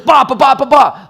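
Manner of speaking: loud, high, forceful barrage of syllables; punchy, loud sales attack; lion mode. Shown as a demonstration. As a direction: fire it off like an aggressive lion. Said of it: "I'm in lion mode right now".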